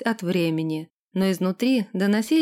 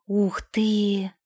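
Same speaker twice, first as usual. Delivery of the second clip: breathed, whispery wow of wonder; hushed admiration. A narrator's reading. Ирга seeing a real tavern, whispered delight. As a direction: marvel under your breath. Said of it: "восхитилась она".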